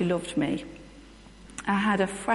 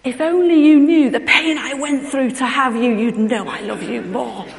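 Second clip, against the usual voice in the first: much louder and higher, intense and dramatic; Jack Nicholson style; 5 semitones higher, 11 dB louder than usual. Retold or imitated. Mother silently retorting to her daughter, dramatic Jack Nicholson-style outburst. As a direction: deliver it like a courtroom outburst. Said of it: "in a kind of Jack Nicholson".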